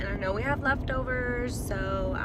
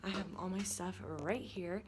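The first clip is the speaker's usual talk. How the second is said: whispering; 4 semitones lower, 8 dB quieter than usual. Real, not acted. Whispering while at work.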